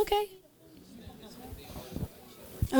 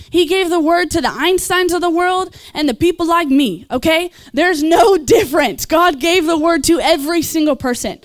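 a louder, driving sermon push; rising preaching; 3 semitones higher, 3 dB louder than usual. Real, not acted. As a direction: build the fervour line by line. Preaching about God's word.

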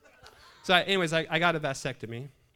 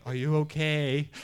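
slow, loud, imitated bedside check; mock concerned nurse; 4 semitones higher, 7 dB louder, slower than usual. Nurses checking on the patient, mock concern. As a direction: draw it out, louder, as an exaggerated check-in.

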